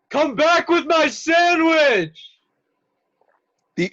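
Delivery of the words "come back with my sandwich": very high, loud, slow shout; scream. Acted. Jonah screams after the fleeing sandwich thief, very loud. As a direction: scream it down the office. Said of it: "and screams".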